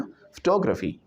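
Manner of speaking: फोटोग्राफी as fuh-TOG-ruh-fee; correct pronunciation. Shown as a demonstration. Teacher models फोटोग्राफी for class, fuh-TOG-rufee. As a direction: hit TOG in फोटोग्राफी, weak vowels round it. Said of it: "ए हटा दिया हमने".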